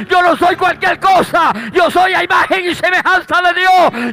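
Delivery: loud, driving exhortation; fiery preacher's cry of faith; preaching at full force. Acted. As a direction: shout it to the congregation.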